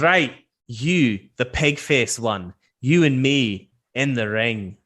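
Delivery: slow, deliberate call-out in a put-on accent; taunting, with the last jibe drawn out and dropping; challenging dwarf brawler. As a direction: throw down a challenge to a fight. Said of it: "he points at you, Tyker, and he goes".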